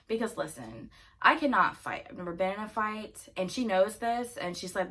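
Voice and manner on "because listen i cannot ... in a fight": ordinary talk, nothing marked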